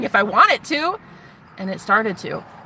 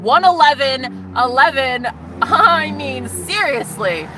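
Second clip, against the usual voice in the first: higher, louder giddy laughing; excited exclaim; 5 semitones higher, 4 dB louder than usual. Real, not acted. Spotting the number one eleven, giddy and laughing. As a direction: burst out thrilled.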